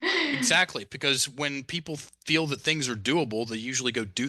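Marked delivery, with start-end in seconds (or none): 0.0-0.8 Exactly stretched up high and bright; Exactly sung out high, falling; emphatic high exactly. Real, not acted. Repeated word, pitched much higher.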